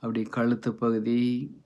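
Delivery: slow, level relaxation voice with long pauses; guided meditation. Acted. Host relaxing listeners' bodies from head downward, guided meditation. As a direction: ease them through it phrase by phrase, stretching words.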